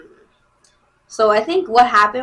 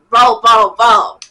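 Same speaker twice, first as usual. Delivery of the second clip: loud exasperated call of bro; fed-up shout; 10 dB louder, much slower than usual. Real, not acted. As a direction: yell it out in disbelief. Outburst at his claim.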